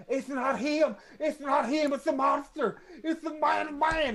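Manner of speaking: high, loud panicked screams; screaming. Acted. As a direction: shriek it in terror. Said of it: "just screams".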